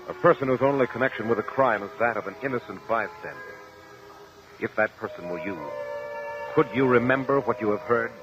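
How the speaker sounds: usual speaking voice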